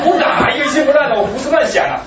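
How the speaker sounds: high, loud, incredulous protest; exclaimed denial. Retold or imitated. Retold protest at a compliment.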